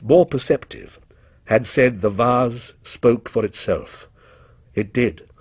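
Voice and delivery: usual speaking voice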